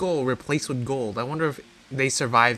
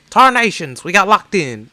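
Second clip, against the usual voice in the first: cowboy complaint; 4 semitones higher, 8 dB louder than usual. Acted. Trapped bandits complaining.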